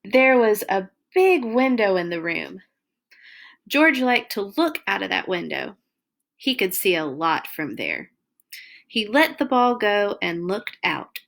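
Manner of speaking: put-on lively read-aloud for little kids; reading to children. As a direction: tell it like a bedtime storybook. Reads picture book to children.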